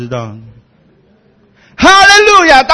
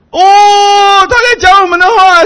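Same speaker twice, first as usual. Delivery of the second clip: excited crowd cry; 8 dB louder, much slower than usual. Retold or imitated.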